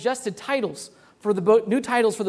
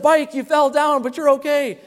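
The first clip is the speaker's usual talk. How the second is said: imitating toddler; 6 semitones higher, 4 dB louder than usual. Dad mimics daughter comforting her fallen bike, higher child voice. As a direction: play your two-year-old comforting a bike. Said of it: "She said".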